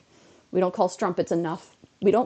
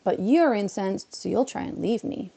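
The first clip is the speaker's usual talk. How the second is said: ordinary talk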